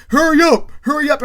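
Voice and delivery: loud, high impatient call; rushing. Retold or imitated. Junior knocking, rushing Ebony, impatient shout. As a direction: holler through a door to rush someone. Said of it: "rushing Ebony".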